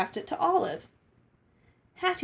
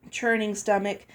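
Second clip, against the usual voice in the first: ordinary delivery, nothing marked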